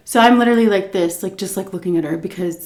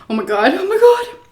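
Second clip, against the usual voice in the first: panicked mock gasp; 12 semitones higher than usual. Terrified passenger in car.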